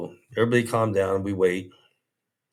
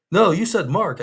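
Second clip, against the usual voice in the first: accusing; 10 semitones higher, 6 dB louder than usual. Retold or imitated. Ben accusing him.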